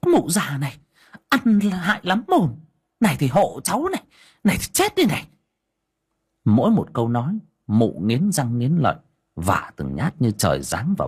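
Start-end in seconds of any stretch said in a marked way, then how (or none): 0.1-5.3 high, vicious yelling curse; cursing while hitting. Acted. Daughter-in-law beating the old woman, cursing viciously. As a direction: spit insults as each blow lands. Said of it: "vả đôm đốp vào miệng mà chửi".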